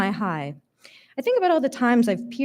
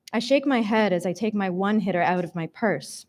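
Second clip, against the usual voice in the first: ordinary delivery, nothing marked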